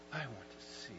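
very soft, simple request; hushed plea. Acted. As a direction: answer quietly, as the blind man to Jesus. Blind man's hushed answer to Jesus.

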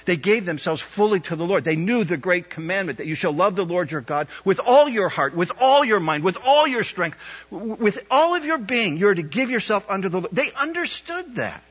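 impassioned build to a peak; building preaching. Acted. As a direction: climb with each "with all your".

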